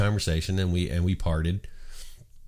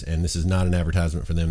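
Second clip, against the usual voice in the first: ordinary talk, nothing marked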